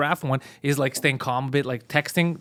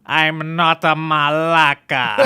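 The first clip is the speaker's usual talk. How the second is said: very slow, drawn-out put-on voice; imitated giraffe woman; 4 dB louder, much slower than usual. Giraffe woman turning round to the yelling man, slow imitated protest. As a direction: stretch each word in a mock protest. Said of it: "the giraffe woman turns around".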